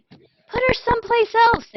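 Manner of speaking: high, loud angry shout. Acted. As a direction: yell it at the coach, fed up. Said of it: "they shouted".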